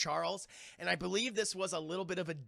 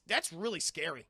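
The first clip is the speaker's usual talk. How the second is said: normal talking voice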